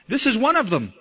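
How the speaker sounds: single him out to the crowd; pointing servant girl. Acted.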